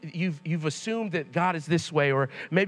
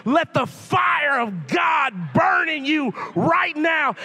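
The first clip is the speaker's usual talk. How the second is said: slow, loud, higher fiery preaching cry; preacher shout; 8 semitones higher, 4 dB louder, slower than usual. Retold or imitated. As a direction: thunder it like a preacher in full flow. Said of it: "my dad's like".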